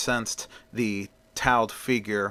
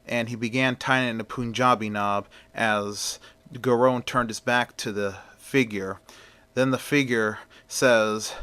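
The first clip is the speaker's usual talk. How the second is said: ordinary delivery, nothing marked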